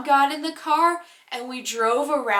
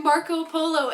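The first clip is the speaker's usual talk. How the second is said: normal talking voice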